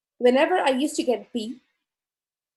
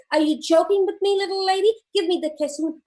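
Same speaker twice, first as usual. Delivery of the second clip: gruff Bigfoot demand; 6 semitones higher than usual. Retold or imitated. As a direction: demand it, half threatening. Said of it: "Bigfoot says".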